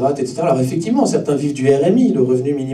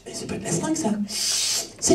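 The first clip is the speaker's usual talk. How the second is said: whispering; 13 semitones higher, 9 dB quieter than usual. Acted. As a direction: breathe the excuse in a whisper. Addict whispers a denial. (chuchoté)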